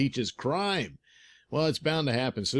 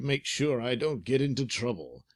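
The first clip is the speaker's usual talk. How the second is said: ordinary delivery, nothing marked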